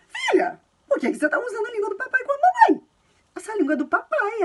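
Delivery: very high, bright sing-song mother teasing her daughter; playful mom voice. Retold or imitated. Mother playfully asking her daughter why she speaks Dad's language, in a very high teasing voice. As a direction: pitch it way up, like a mom gently scolding in play. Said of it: "eu falo".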